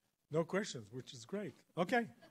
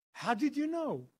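high, louder startled question; retold astonishment; 10 semitones higher, 6 dB louder than usual. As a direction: imitate her amazed demand. Helen's startled question on phone.

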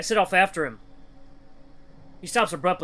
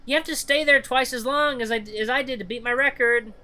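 put-on high-pitched kid voice; taunting child; 8 semitones higher than usual. Child daring boy to beat record, high taunting voice. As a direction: lay down the dare in a child's voice.

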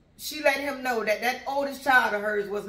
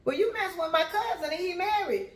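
unspoken sass; 6 semitones higher than usual. Retold or imitated. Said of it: "thinking to myself".

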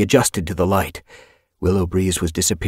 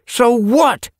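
loud high defiant retort; 6 dB louder than usual. Acted. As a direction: snap back defiantly. Defiant reply in quarrel.

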